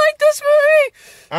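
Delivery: high, slow, swooping voice; high hammy question. Pretend. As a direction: stretch it out way up high. Host asks with hammy swoop.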